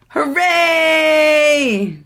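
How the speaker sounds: loud, high hooray; cheering. Acted. Friends celebrating the cure, loud cheer. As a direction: cheer it out for the kids.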